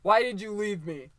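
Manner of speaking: loud, accusing put-on cry; mock angry son. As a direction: hurl it at the mother like a wounded son. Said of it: "he's not like".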